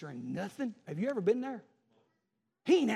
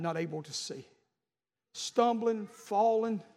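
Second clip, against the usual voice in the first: ordinary delivery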